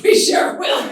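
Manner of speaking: loud, laughing, knowing reply. Retold or imitated. Teen agreeing to parents, loud and laughing. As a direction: say it with a big suggestive laugh. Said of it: "I was like".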